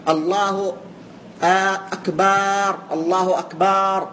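أكبر ending in a long "baaar"; third wrong form. Shown as a demonstration. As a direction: drag out the last vowel of الله أكبر. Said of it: "والصورة الثالثة".

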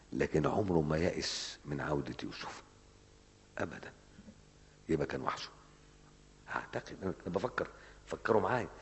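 normal talking voice

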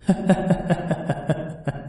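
soft, echoing maniacal cackle; distant staccato cackling that falls away; savage ghost laugh. A narrator's reading. Ghost at Lý's feet taunting her, wild eerie laugh. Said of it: "một tiếng cười man dợ".

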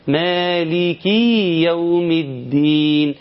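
very slow chant, مالك drawn out into maliki with a long ee; added ya. Shown as a demonstration. Teacher showing a reciter's mistake, extra long ya. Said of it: "هيقوم يضيف ياء".

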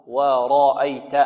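ورأيت with a light waw, drawn out slowly. Shown as a demonstration. Teacher showing a light waw. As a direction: keep the و light and slow. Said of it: "الواو هنا مرققة".

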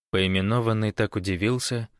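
usual speaking voice

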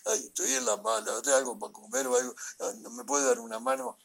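high, whimpering plea; mock a sniveling beggar begging for help; whining beggar. Mocking the thugs who come crying for help, high whine. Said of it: "van llorando, piden ayuda".

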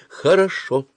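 high, mildly threatening word. A narrator's reading. Mother giving up on her son, threateningly. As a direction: say it as a warning. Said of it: "угрожающе сказала она".

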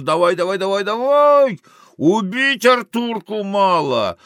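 high, loud, excited urging; urging shout. Acted. Gambler in the carousel urging, high excited shout. Another voice urges, then rages.

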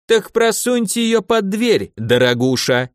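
slow, high, mocking jailer. A narrator's reading. Jailer mocks the lady through the cell door, slow and sneering. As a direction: sneer it at her. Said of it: "с издёвкой произнёс голос".

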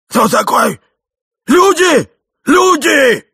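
full-voiced, high-pitched yells; alarmed shouting. A narrator's reading. Old man finding intruders, loud high shouts. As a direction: bellow for help, rising very high.

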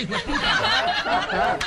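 high, loud, stretched-out yell of the insult; shouted punchline. Acted. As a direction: drag it out and yell it.

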